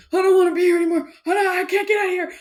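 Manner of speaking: high, loud and fast, panicky; mock panic. Gamer retelling the zombie locker jump scare, mock panic. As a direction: blurt it like you're trapped and scared. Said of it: "and I was like".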